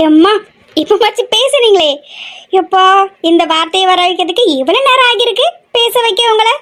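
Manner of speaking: high, playful exclamation; teasing relief. Acted. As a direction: tease her for finally speaking up. Teasing silent sister-in-law who finally spoke. (கிண்டலான உற்சாகம்)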